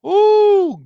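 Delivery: high loud ooh; excited ooh. Real, not acted. Streamer reacting to chat with a loud ooh. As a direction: let out a thrilled ooh.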